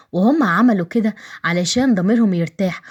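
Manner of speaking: ordinary delivery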